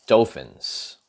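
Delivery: ordinary delivery, nothing marked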